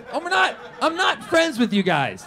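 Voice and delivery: high, loud objection; acted-out indignant outburst; exasperated protest. Objects to sudden friendliness in cab.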